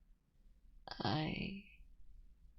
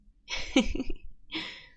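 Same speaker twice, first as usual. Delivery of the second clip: relieved teary laugh; 6 dB louder than usual. Acted. Relieved after partner stays.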